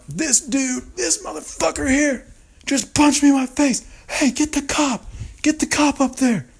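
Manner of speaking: very high-pitched mock scream; screaming snitch. Punched man screaming for the cop, snitching hard. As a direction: screech it like you're frantically calling for police. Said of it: "just screaming, like, snitching so hard".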